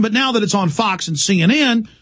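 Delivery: ordinary talk, nothing marked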